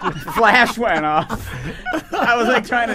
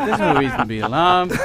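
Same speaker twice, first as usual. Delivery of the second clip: imitating a reassuring pilot; lightly voiced calm captain; 7 semitones lower, 3 dB quieter than usual. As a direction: lower soothing captain. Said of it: "but he's like".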